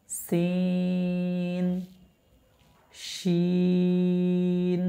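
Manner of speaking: সিন held very long, three alif lengths; drawn-out letter. Shown as a demonstration. Teacher drawing out সিন for the class. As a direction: stretch সিন out slowly. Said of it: "তিন আলিফ পরিমাণ টানবো".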